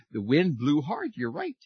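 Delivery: normal talking voice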